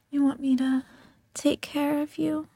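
ordinary delivery

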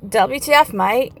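mock Australian with a rising mate; Australian accent. Retelling the video, a light Australian accent. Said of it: "Australia's down there like".

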